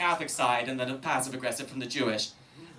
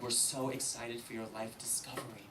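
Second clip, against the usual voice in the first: soft, gentle imitation of a priest; hushed, mock-pastoral encouragement; priest's voice; 3 semitones lower, 9 dB quieter than usual. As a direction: confide it quietly, like kindly clergy. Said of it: "Jesuit priest came up to me".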